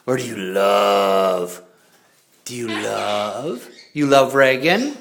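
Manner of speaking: slow, loud sing-song coaxing; slow drawn-out coaxing. Real, not acted. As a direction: stretch it out for the bird.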